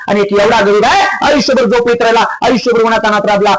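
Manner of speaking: high, ringing sermon storytelling; impassioned preaching. Real, not acted. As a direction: launch high and drive the parable on, easing down at phrase ends. (आवेशपूर्ण kirtan)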